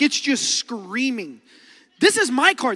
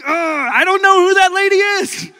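loud denial; 8 semitones higher, 9 dB louder than usual. Retold or imitated. Embarrassed teen disowning his mom to classmates, loud denial. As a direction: proclaim it over her calls.

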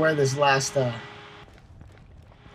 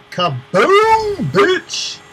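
high, loud triumphant yell; gloating shout; 10 dB louder, much slower than usual. Real, not acted. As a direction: crow over the explosion. Grenade explodes, gloating.

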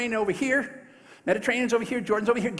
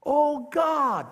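higher, raised cry to God; fervent prayer; 7 semitones higher, 4 dB louder than usual. Acted. Elisha crying out to God in the city. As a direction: lift it up as an urgent plea. Elisha prays for eyes opened.

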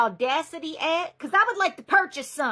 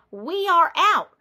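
slow, each word spelled out; firm slow repeat; 4 dB louder, slower than usual. Acted. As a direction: lay it down word by word. Repeating firmly to a customer.

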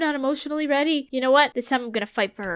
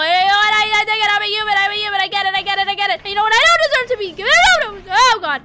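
higher and louder flustered babble; frantic rant; 7 semitones higher, 7 dB louder, faster than usual. Real, not acted. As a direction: spiral into panic. Flustered while Undyne attacks.

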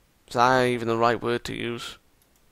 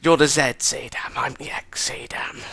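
breathy private whisper. Acted. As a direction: whisper it to yourself. Whispered aside to self.

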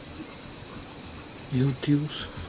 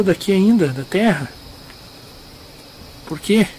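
calling out; 7 semitones higher than usual. Real, not acted. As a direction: call into the graves. Calling out to crying spirit.

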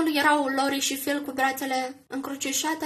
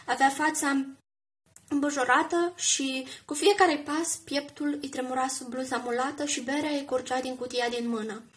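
ordinary delivery

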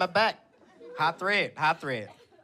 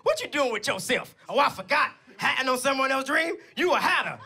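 confident aunt, pitched up; Aunt Kathy; 4 semitones higher than usual. Retold or imitated. Aunt defending her nephew from a hater, confident mock scolding. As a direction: imitate an aunt telling off a hater. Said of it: "and this is how she talks".

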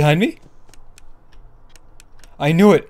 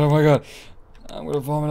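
ordinary talk

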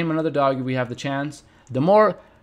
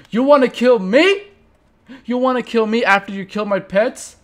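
indignant challenge; 6 semitones higher, 7 dB louder than usual. Real, not acted. Confronting the creeper.

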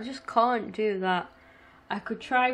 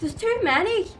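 panicked; 10 semitones higher, 5 dB louder than usual. Real, not acted. Overwhelmed by enemies.

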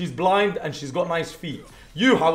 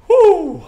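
high loud relieved whoop; loud whew; 14 dB louder than usual. Real, not acted. Streamer whoops after surviving a fight, loud relief. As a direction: let out the relief loudly.

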